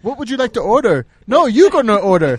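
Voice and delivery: put-on lightly done Indian accent, a bit quick and loud; mock Indian pizza clerk, pushing the order; playful Indian accent as a pizza clerk. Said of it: "Sorry for the accent".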